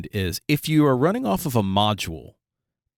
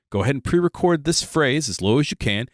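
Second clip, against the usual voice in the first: ordinary talk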